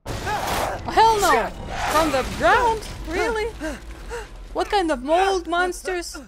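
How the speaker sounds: high, loud cries of disbelief; incredulous, high-pitched shouting; alarmed shouting. Real, not acted. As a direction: yell in real alarm as monsters appear. Monsters rise from the ground.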